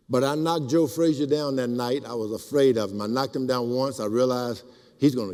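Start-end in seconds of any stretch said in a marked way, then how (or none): none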